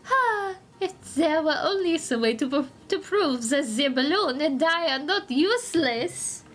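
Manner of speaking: earnest cartoon Frenchman; French-accented Antoine. Vowing to repair the balloon, earnest French accent. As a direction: put on a lively French accent.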